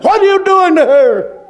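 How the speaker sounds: high, forceful demand; retold confronting shout. Preacher retells confronting the man over the woman, shouting.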